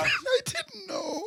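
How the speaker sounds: high, slow words squeezed out through laughter; can barely speak for laughing; laughing helplessly. Real, not acted. Helpless laughter at own mistake.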